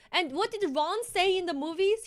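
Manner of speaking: normal talking voice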